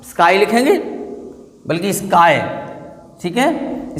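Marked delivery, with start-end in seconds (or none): none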